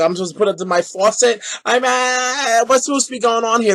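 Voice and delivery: ordinary talk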